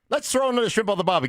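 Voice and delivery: high, fast mock Australian accent; hammed-up Aussie accent. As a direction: slide into a cartoon Australian.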